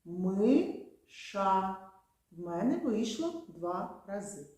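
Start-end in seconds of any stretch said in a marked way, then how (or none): 0.0-1.8 «Миша» said slowly in two beats, МИ-ША; «Миша» spoken syllable by syllable for the chin test; syllables tapped out. Shown as a demonstration. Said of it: "Давайте спробуємо разом".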